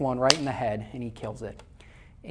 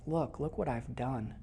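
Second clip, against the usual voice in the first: put-on tearful boy; 10 dB quieter, slower than usual. Boy crying holds dead chicken.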